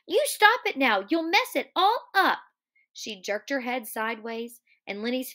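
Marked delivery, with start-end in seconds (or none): 0.1-2.4 high, loud indignant scolding; raised-voice angry protest; angry cry. Acted. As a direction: order him off angrily. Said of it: "And then she cried angrily".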